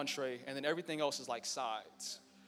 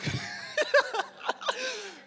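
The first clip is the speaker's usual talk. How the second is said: high loud laughing fit; real burst of laughter; 7 dB louder than usual. Real, not acted. Speaker cracking up telling the breakup story, real laughter.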